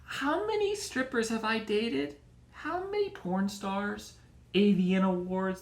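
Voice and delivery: dreamily counting past conquests; inner voice of the friend. Retold or imitated. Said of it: "I'm like".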